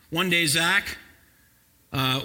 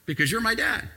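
put-on calm child; 7 semitones higher than usual. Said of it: "he responded with calmness".

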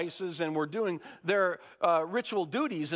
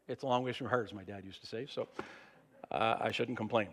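ordinary delivery

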